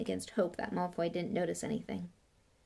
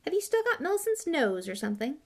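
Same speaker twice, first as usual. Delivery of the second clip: high, wary question; tentative, pitched up; uncertain; 8 semitones higher, 4 dB louder than usual. A narrator's reading. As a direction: venture an awkward guess. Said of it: "said Ron uncertainly".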